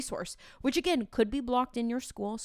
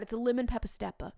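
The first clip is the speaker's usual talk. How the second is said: lemon pepper stepper rattled off fast as one word; the teacher showing the real way, quick; quick natural; faster than usual. Shown as a demonstration. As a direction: say it quick and run together. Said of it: "you can't say it like that".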